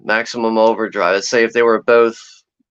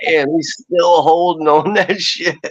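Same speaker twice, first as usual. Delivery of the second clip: laughing; 5 semitones higher than usual. Retold or imitated. Retold amused thought.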